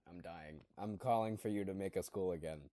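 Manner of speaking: ordinary delivery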